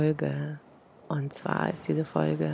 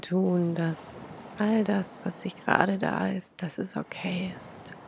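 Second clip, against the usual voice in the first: guided meditation; 6 dB quieter than usual. Acted. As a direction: breathe it out softly, long pauses.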